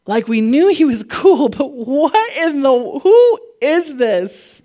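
hammed-up bewilderment, drawn out very slowly; stunned disbelief. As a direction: play the flabbergasted disciple, stretching the question. Acts out the disciples' stunned amazement.

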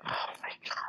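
breathless whispered oh my God; whispered disbelief. Real, not acted. Disbelief at ranking.

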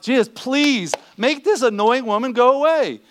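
a hint of disciples pleading to be rid of her; annoyed disciples. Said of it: "They're like".

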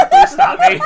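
high squealing laugh through words; can barely get it out for laughing. Real, not acted. Cracking up at own bit.

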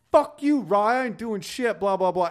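very high, loud defiant mimic; mock his tough talk, high and loud; mocking Jamie. Imitating Jamie mouthing off.

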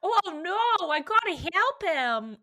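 high, loud hammed-up Brooklyn accent. Co-host acting out the cartoon scene as Molly, hammed-up Brooklyn accent. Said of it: "Molly's Brooklyn accent have a serious conversation".

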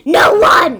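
loud, emphatic "No one!"; indignant shout. Real, not acted. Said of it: "so she does not believe it".

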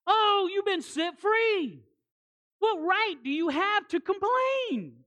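loud, high, slow indignant scold; mock scolding. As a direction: scold them like a jealous churchgoer. Voicing scolding church people.

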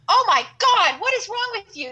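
high, loud shocked teacher voice; exasperated teacher. Retold or imitated. Teacher scolding the girl cussing in class, shocked and high. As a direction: play the teacher yelling in disbelief. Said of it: "my teacher was like".

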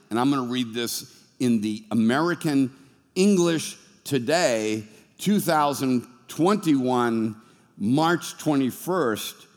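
ordinary talk, nothing marked

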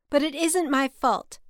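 even tinier, high little voice; teenier voice. A narrator's reading. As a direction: squeak out a small protest. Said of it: "she said in a teenier voice".

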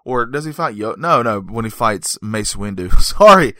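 usual speaking voice

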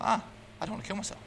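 high, quick, soft burst of realization; telling a friend the moment the dark thought suddenly lifted; sudden relief. Retold or imitated.

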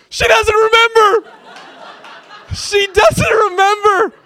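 the brother screaming in delight that his sister forgot; gleeful scream. Retold or imitated. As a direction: shriek it out like a kid who just got away with it. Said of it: "and he screams".

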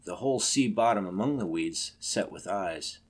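usual speaking voice

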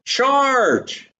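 high shouted battle cry; yelled command. Retold or imitated. Deborah to her army, giving the signal: high yelled charge. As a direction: call the whole army to attack. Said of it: "Deborah yells".

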